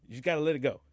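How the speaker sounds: playful mock ruling to the fans; Marvel talking. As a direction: lightly voice the studio closing the case. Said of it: "Marvel's way of saying".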